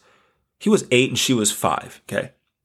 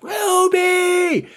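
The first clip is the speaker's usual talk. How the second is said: scream. Retold or imitated. Father screaming daughter's name at the road, piercing shout. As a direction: cry out to stop her in time. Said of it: "I, like, scream".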